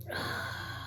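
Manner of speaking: soft, drained exhale; acted weary breath out; exhausted sigh. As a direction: sigh as if too tired to move. Showing how tired her body felt.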